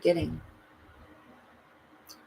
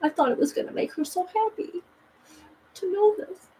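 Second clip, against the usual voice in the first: high, tearful woman's voice; lightly voiced crying woman; 11 semitones higher than usual. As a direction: voice her upset, pitched up. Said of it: "she was like".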